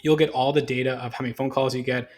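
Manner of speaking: ordinary talk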